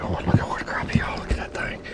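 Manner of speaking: whispered so the boat behind won't hear; awed whisper over the catch; hushed whisper. Real, not acted. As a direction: keep it secret, whisper your delight. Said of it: "being a little bit hush-hush".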